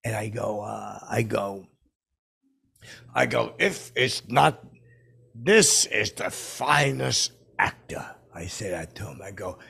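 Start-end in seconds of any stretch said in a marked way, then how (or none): 3.6-8.1 slow, louder impression with grand announcer weight; stately slow announcement, louder; Morgan Freeman. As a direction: proclaim it slowly, word by word. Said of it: "I do, like, Morgan's voice".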